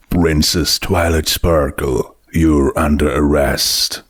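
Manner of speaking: low, stern sheriff voice; low voiced cowboy sheriff; sheriff arresting. A narrator's reading. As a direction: lay down the law low and firm. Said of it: "the sheriff said".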